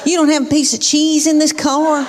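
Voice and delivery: lightly voiced whiny preteen in the car; complaining daughter. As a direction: imitate a dramatic, demanding eleven-year-old. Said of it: "she says something like this".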